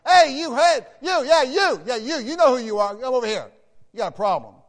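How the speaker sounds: loud, high mock call; hammed-up shout, fingering the guilty one; ironic quiet and humble. James calling out the readers, a loud ironic shout. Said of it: "in a quiet, humble way".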